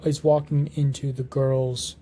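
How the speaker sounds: ordinary talk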